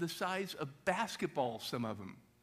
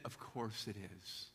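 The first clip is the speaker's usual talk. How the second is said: soft, low and slow, gently giving way; gracious concession; 6 semitones lower, 9 dB quieter, slower than usual. Retold or imitated. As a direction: give it up kindly, almost under your breath. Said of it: "I said".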